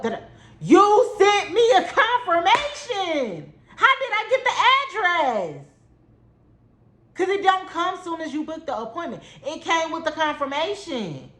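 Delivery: high exasperated retort; incredulous rant. Real, not acted. Heated rant at stylist.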